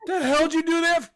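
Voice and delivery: loud, high-pitched outraged squawk; mock indignation. Ketchup victim looking at his attacker, in mock outrage. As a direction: protest in a comically high, loud voice.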